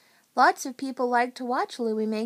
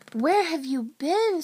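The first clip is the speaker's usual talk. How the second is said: higher, louder demand; demanding question; 5 semitones higher, 5 dB louder than usual. A narrator's reading. Suzanne to late Becky, demanding. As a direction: ask as if mildly put out.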